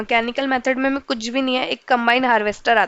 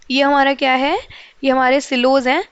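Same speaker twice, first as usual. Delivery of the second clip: ordinary talk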